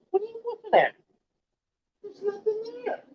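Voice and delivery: very high, soft coo to a pet; high-pitched talk to an animal. Real, not acted. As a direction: gently question a pet in a high little voice. Talking to a pet.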